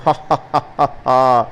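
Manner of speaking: slow, drawn-out villain's cackle; wolf's evil laugh. Acted. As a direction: laugh like a gloating wolf. Wolf gloats after threat. (నెమ్మదిగా దుష్ట తోడేలు నవ్వు)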